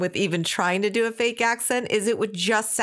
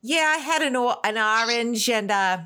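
mock a mother's picked-up New York accent; put-on New York accent. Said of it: "she'd have this accent that she didn't have".

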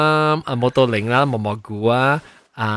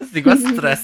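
talking through laughter; laughing; 10 semitones higher than usual. Real, not acted. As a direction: crack up while finishing the sentence. Jokes about own weight gain. (笑笑仔講)

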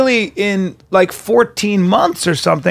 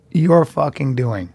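ordinary talk

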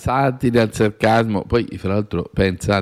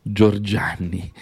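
ordinary talk, nothing marked

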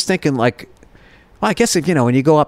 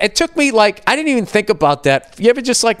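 normal talking voice

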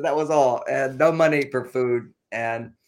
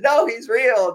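put-on high, louder child's voice; little boy insisting; 11 semitones higher, 7 dB louder, slower than usual. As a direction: plead like a small boy guarding his toy. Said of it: "and he says".

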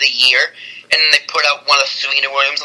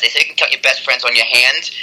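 ordinary talk, nothing marked

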